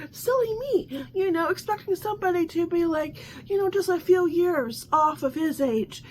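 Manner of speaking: mock your own naivety; sarcastic self-mockery; mock silly me. Real, not acted. Mock self-deprecation, high voice.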